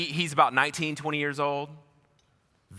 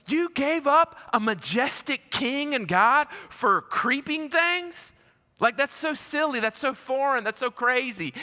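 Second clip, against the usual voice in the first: much higher, slow, mock disbelief; incredulous mockery; 13 semitones higher, 5 dB louder than usual. Preacher mocks people trading God for creeping things, much higher and slow in disbelief. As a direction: act stunned at such a silly trade.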